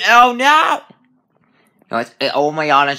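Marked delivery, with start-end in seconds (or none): 0.1-0.8 high, loud scared cry; real jump-scare panic; frightened yelp. Real, not acted. Player hit by a jump scare, panicked yelp.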